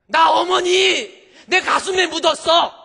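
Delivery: slow, high, full-force scream; hammed-up screaming grief and fury; anguished shout. Daughter-in-law who buried her mother, slow anguished scream. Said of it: "이렇게 소리 지를 일은 아닌데".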